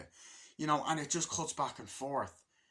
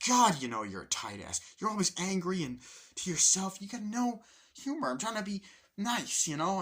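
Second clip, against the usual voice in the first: indignant comeback; 6 semitones higher, 3 dB louder than usual. Retold or imitated. Roommate trying to be nice hits back, indignant protest. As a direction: pitch climbing in hurt protest.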